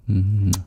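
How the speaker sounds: loud "mwah" kiss; affectionate smooching mwah; kiss sound. Pretend. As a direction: blow the listener an audible kiss. Blown kiss after thanking viewer.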